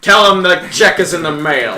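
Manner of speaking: loud, punchy movie tough-guy impression; Kurt Russell. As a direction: belt it out as a film action hero. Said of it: "What's a good Kurt Russell line?".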